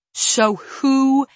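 high, loud, derisive challenge; suppressed anger. A narrator's reading. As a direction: hold the anger just under. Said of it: "swallowing back her anger now".

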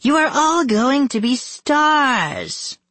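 very high, slow proclamation; high, drawn-out wicked delight; exultant villainess. A narrator's reading. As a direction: announce it with grand glee. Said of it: "Margot demanded".

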